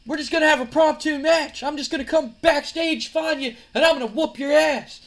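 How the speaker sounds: high, loud wrestling promo; angry threat. Acted. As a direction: loudly threaten a rival in front of a crowd. Acted wrestler threatening his rival.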